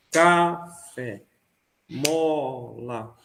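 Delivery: "café" and "mola" split into long beats, CA... fé, MO... la; first-syllable pulse. Shown as a demonstration. Teacher turning words into binary pulse, stressed first syllable. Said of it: "que a sílaba tônica seja a primeira".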